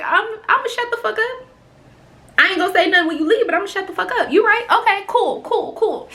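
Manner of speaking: high, fast, mock-agreeable; sarcastic high backing down. Backing down to the rude lady in line, sarcastic and high.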